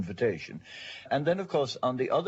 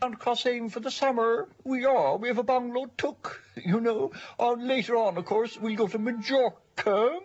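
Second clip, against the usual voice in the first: high-pitched, affected middle Cork accent; put-on high Cork voice; middle-of-the-road Cork accent; 11 semitones higher than usual. Shown as a demonstration. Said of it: "there's the middle-of-the-road accent".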